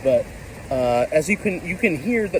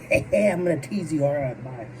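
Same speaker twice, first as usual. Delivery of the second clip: teasing sneeze voice. Retold or imitated. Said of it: "a magical sneeze that was like".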